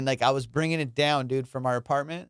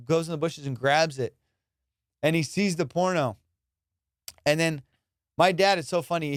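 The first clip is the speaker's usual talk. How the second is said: ordinary talk, nothing marked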